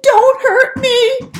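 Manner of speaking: high, loud, slow frightened crying plea; whimpering. Acted. Frightened lion begging the mouse, whimpering high. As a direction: beg for mercy through tears. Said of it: "he whimpered".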